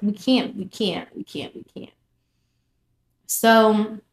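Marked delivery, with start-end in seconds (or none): 0.0-1.9 from normal down to a whisper; fading to whisper. Retold or imitated. Telling her friend they can't go on, fading to a whisper. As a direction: let it die to a whisper.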